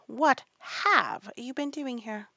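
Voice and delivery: high, loud, drawn-out demand; interrogating queen. A narrator's reading. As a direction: demand an answer imperiously. Angry Queen interrogates gardeners.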